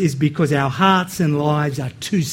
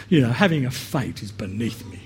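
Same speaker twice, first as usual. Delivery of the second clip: haughty aristocrat; 8 semitones lower, 8 dB quieter than usual. Retold or imitated. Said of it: "some of you are better actors than me".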